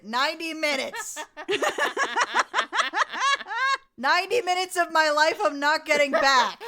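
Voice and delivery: loud, laughing exasperation; exasperated rant. Real, not acted. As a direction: complain loudly, half laughing. Laughing rant about movie length.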